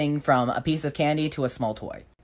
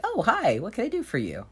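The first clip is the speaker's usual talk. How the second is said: fake sweet; 9 semitones higher than usual. Narrator greets bully sweetly.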